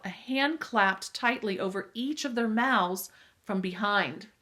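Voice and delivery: plain reading voice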